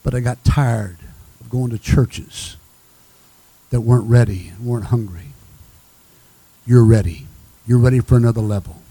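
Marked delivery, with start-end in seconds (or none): none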